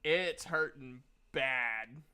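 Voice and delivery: ordinary talk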